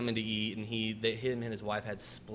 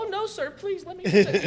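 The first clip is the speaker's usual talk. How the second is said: very high, loud fake pleading; hammed-up cowering before a bully; mock grovelling; 11 dB louder than usual. Server pretending to bow to angry customer, mock grovelling.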